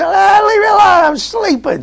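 hammed-up sleepy kid; sleepy child whine. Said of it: "and they say".

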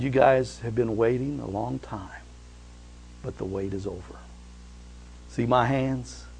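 soft, tender reassurance; gentle Jesus. Acted. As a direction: speak softly, comforting those waiting. Jesus voiced softly.

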